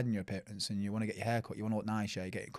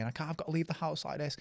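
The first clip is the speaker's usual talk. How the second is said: higher, softly imitated distress; tearful mum; 6 semitones higher, 4 dB quieter than usual. Mum who can't go out like this, mock-tearful. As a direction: lightly act out an upset mum. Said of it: "She was crying".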